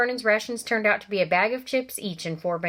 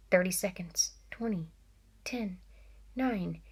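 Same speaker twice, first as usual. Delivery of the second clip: softer and softer countdown; hushed countdown; 7 dB quieter than usual. A narrator's reading. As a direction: count down under your breath. Counting down to midnight.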